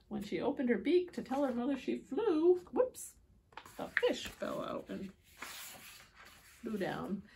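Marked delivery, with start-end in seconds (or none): none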